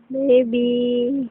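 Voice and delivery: normal talking voice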